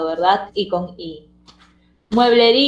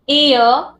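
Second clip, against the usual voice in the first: "Io" loud and higher, joined as one syllable; diphthong as one syllable; 5 semitones higher, 5 dB louder than usual. Shown as a demonstration. Teacher identifying the diphthong, "Io" punched as one syllable. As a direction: glide "Io" together with force. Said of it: "el diptongo".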